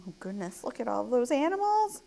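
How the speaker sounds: ordinary delivery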